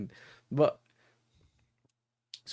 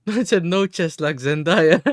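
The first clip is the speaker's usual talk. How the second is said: laughing; 5 semitones higher, 10 dB louder than usual. Real, not acted. Laughing at friend's answer.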